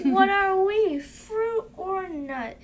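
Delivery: loud high puzzled question, slowing down; puzzled outcry. Acted. Child voicing a confused newcomer, loud high and puzzled. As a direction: ask it in loud bafflement.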